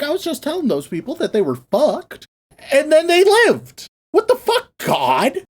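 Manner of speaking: indignant mock complaint, pitch leaping up; Jonah, pissed. Prophet complaining to God about spared sinners, mock indignant. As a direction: protest in exasperated disbelief, drawing out the words. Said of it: "Jonah's kinda pissed".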